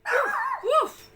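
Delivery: high, playful puppy woofs; excited pup barking. A narrator's reading. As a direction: bark like a little pup. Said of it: "Lucky barked".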